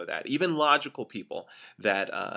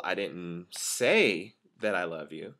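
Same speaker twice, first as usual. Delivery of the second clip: "say" drawn out long, the rest lower; stress on "say"; 4 semitones lower, slower than usual. Shown as a demonstration. Trainer showing how tonality changes a statement, stress on "say". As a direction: lean hard and slowly on the word "say". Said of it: "Putting that emphasis on say".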